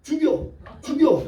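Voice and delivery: high-pitched mock defiance; stubborn kid's challenge. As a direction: imitate a beaten kid charging back, comically. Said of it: "이러고 달려드는데요".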